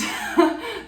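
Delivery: sudden high-pitched laugh; high burst of laughter. Real, not acted. Light suddenly turned on.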